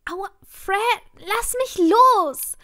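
high, loud, drawn-out plea; frightened cry. A narrator's reading. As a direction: cry out in pain and fear, rising to a shout. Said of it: "rief ich ängstlich". (ängstlicher, lauter Aufschrei)

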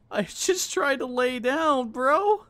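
high, drawn-out wailing complaint; exasperated whine. Real, not acted. Gamer who just tried to lay down, exasperated high whine. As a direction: stretch it slowly into a high whine.

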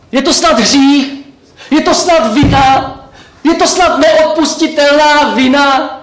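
building from high pleading to a loud shout; desperate outcry. Acted. Pig pleading, rising desperation.

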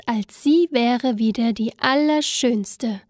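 ordinary delivery

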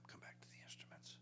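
whispered cue to the band; whispered instruction offstage. Real, not acted. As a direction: hush it aside to the musicians.

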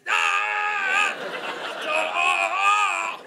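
loud, high held "aah"; long hollered cry of childish joy; gleeful full-volume kid holler; excited kid yell. Acted. Acting out an overexcited little kid.

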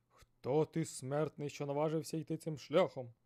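loud booming sea serpent; thunderous serpent voice. Acted. Giant serpent challenging the fisherman, loud and booming. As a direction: boom it like thunder. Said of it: "голосом, що нагадував грім".

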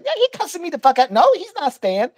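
imitated high-pitched complaint, pressing for agreement; indignant clerk. Clerk on phone call with the manager, mock indignant. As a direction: act out her worked-up phone rant, pitched well up. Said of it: "And she's like".

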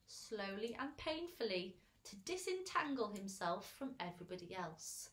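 plain reading voice

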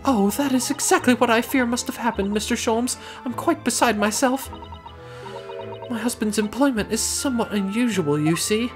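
high-pitched refined lady. Acted. Worried client speaks.

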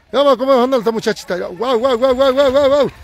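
high, rapid-fire exclamations; excited marvelling. Real, not acted. Commentator watching a girl race downhill, excited. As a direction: race through it, pitch climbing on the wows.

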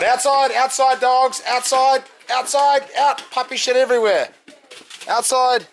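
high, loud command to the dogs; loud, high calling out. Real, not acted. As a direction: shoo the dogs outside firmly. Shooing dogs out of house.

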